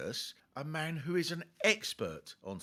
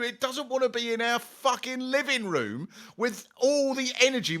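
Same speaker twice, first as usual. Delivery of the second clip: ordinary talk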